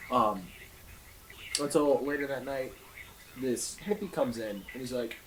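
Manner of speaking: ordinary delivery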